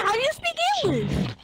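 very high, loud shriek of disbelief. Real, not acted. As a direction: push the question into a shriek. Repeating, even higher.

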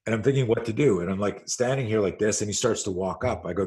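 normal talking voice

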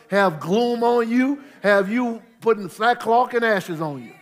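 loud, high preaching; preaching at full force. Acted. Preacher urging the church to get up, loud. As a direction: drive it home, full voice.

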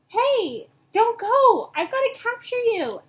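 high, loud calling after them; frantic pleading yell; desperate shout. A narrator's reading. Ash calling after fleeing ghosts.

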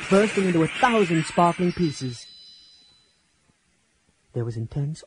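ordinary delivery

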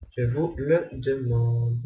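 slow, drawn-out rhetorical flourish; mock-theatrical question. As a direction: stretch it out for mock drama. (question rhétorique lente, faussement théâtrale)

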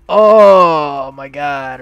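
hammed-up cry of a guy who just got hit; mock pained cry. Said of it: "this guy just got shot, and he was like".

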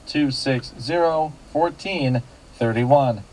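ordinary talk, nothing marked

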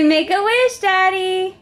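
put-on high, singsong words for a toddler; playful child-directed lilt; prompting the child. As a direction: pitch it up like a little kid.

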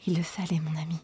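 soft breathy dying whisper; weak whisper. A narrator's reading. Dying Emma to weeping Charles, faint whisper. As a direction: whisper tenderly, no voice.

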